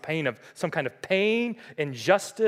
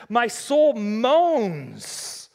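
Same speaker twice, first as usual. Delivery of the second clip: long drawn-out, raised lament; acted moaning; 5 semitones higher, 4 dB louder, much slower than usual. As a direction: stretch the words like an acted moan. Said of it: "this person is crying out".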